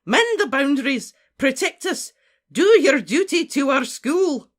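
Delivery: high, loud battle orders; urgent, stretched-out call to arms; rallying shout. Acted. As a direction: command the troops at full voice. Professor rallies statues, shouting.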